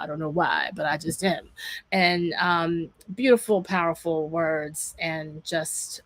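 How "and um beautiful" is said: usual speaking voice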